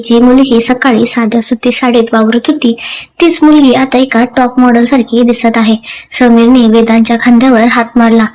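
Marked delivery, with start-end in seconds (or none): none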